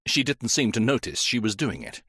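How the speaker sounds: ordinary delivery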